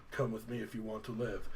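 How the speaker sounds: lightly voiced low, quiet rescuer; old dude. Orin offering Titus rescue, lightly voiced low and quiet. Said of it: "this old dude named Orin".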